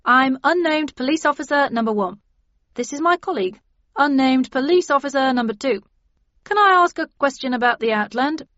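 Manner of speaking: high, loud, formal constable; uniformed police officer. Acted. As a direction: announce yourself stiffly as an officer.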